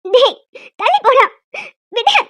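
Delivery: high, loud shout to let go; angry shout. Acted. Woman shouts at Sathya to let her go. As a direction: yell at him to get off you. Woman shoves off grabbing man.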